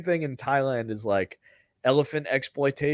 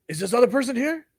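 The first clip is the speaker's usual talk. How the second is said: much higher, urgent question; impatient driver; 10 semitones higher, faster than usual. Retold or imitated. Driver checking for the missing passenger, much higher and impatient. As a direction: demand an answer, impatient. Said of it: "he's like".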